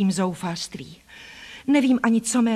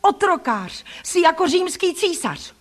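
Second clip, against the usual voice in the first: high-pitched, defiant accusations; angry outcry; 9 semitones higher than usual. A narrator's reading. Girl comparing her cousin to a tyrant, in an angry cry. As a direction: hurl each accusation at him. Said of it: "vykřikla jsem".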